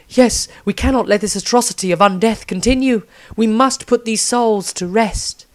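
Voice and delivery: high, righteous priestess voice; Rysandria. Acted. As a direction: speak as a devout priestess. Rysandria speaks.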